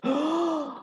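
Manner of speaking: high, short startled gasp; villagers gasp. Acted. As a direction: gasp in shock. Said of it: "All the villagers gasped".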